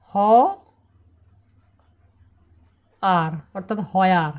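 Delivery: slow, হয়ার with a clear h sound; wrong pronunciation demo. Shown as a demonstration. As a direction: show the common mistake হয়ার slowly. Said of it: "কিন্তু তা নয়।". (ভুল উচ্চারণ, ধীরে হয়ার)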